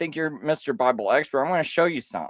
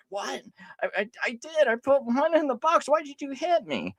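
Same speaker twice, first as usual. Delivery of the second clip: put-on much higher, hurt little child; whiny kid voice; 12 semitones higher than usual. A kid just smacked protests he obeyed, whiny. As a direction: play a confused, wounded kid. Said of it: "The kid's like".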